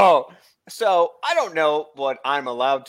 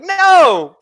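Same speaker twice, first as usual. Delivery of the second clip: much higher, louder blunt no; mock loud no; 13 semitones higher, 7 dB louder than usual. Answering his wife's question, a loud mock no. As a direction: shoot it down hard. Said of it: "And I was like".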